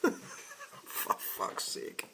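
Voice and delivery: breathy disbelieving laugh into a curse; exasperated laugh. Real, not acted. Driver laughing in disbelief at an online comment, exasperated laugh. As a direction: laugh it off in disbelief, then swear under the breath.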